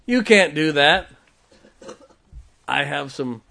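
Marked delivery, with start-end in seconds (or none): 0.1-1.1 loud, slow mock objection; imitated scoffer. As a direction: throw the doubters' words out slowly and forcefully. Scorners quoted, repeated forcefully.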